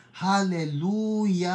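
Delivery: HALLELUJAH with the last syllable held very long; long drawn-out shout of praise. Acted. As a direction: stretch out Hallelujah, high and slow. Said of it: "Mahaba po yun". (mahabang-mahabang Hallelujah)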